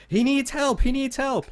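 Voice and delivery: high, loud frantic cry for help; frantic scream. Acted. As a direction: shout it while running in. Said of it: "starts running toward him, screaming".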